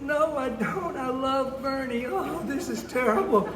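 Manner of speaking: high-pitched, mock-heartbroken wail; distraught daughter. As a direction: lament like a lovesick daughter, hammed up. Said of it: "She started crying".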